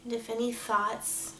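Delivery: soft, measured meditation voice with long pauses; guided meditation. Acted. Meditation leader asking angels and guides for protection, calm and hushed. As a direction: gently lead listeners into calm, leaving space to breathe.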